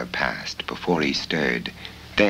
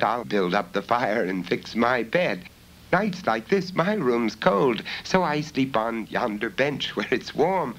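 put-on a high, eager young girl's voice; young girl Bella; 6 semitones higher than usual. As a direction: answer brightly, much higher than the narrator. Bella speaking.

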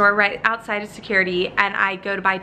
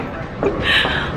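breathy laugh. Real, not acted. Laugh after running late.

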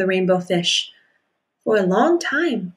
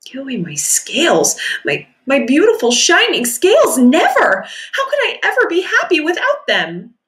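louder, higher cry of refusal; cling to your precious scales; distressed protest; 6 semitones higher, 3 dB louder than usual. Acted. Rainbow Fish refuses in distress.

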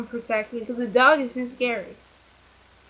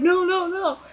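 imitated protest; 8 semitones higher than usual. Friend's refusal acted out.

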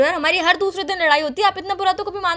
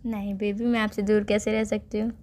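tender plea; 8 semitones lower, 7 dB quieter than usual. Acted. Melodramatic plea, much softer.

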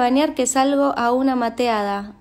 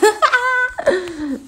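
a burst of helpless laughter; laughing fit; 7 semitones higher than usual. Real, not acted. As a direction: crack up for real. Laughs at her own story. (carcajada real)

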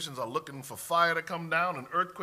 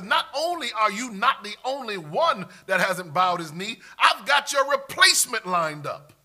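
loud, high preacher's rebuke; forceful pulpit voice as God, climbing; God rebuking Elijah; 5 semitones higher, 6 dB louder than usual. Acted. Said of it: "And God said".

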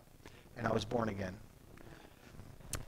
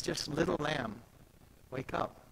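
tender; 7 dB quieter than usual. Retold or imitated. Jesus waking the girl again.

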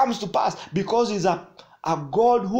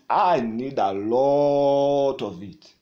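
slow plea; 3 semitones lower, 7 dB louder, much slower than usual. Shown as a demonstration. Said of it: "crying out to God".

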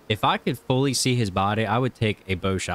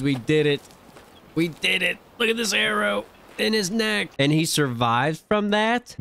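excited; 10 semitones higher than usual. Real, not acted. Kill finally confirmed, celebrating.

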